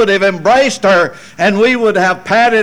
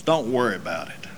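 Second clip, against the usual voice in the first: soft quick reassurance; 8 semitones lower, 9 dB quieter, faster than usual. Real, not acted. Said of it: "repeated reassurance".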